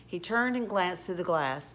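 ordinary delivery, nothing marked

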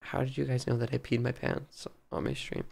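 low, very soft, stunned question; mortified hush. Acted. Streamer realizing chat heard the secret, mortified hush. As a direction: barely get the question out, low and hushed.

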